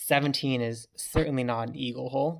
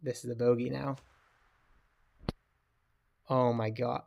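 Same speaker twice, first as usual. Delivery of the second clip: usual speaking voice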